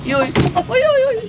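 high sing-song call to a pet; calling the rooster. Real, not acted. Host calling the rooster to come to her, high sing-song.

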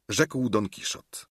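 ordinary delivery, nothing marked

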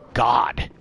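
ordinary delivery

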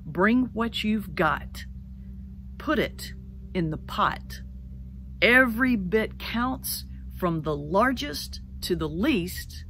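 slow, measured sermon close fading to a whisper; solemn recitation. Acted. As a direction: land the blessing slowly and gently. Closing recited recipe blessing.

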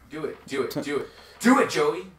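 cheering. Real, not acted. Egging friend on.

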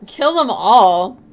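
loud, slow, incredulous echo; loud disbelief. Shown as a demonstration. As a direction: repeat it loudly in disbelief.

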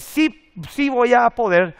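drawn-out confident affirmation; confident declaration. Shown as a demonstration. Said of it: "cuando vos decís". (afirmación lenta y enfática)

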